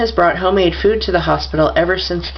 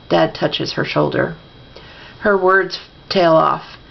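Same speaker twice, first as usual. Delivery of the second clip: usual speaking voice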